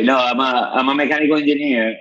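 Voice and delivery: ordinary talk, nothing marked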